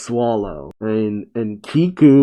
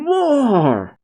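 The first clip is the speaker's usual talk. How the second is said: high, drawn-out mock yell; retold yelling; 12 semitones higher than usual. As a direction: yell for it high without full volume. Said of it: "Luffy yelling".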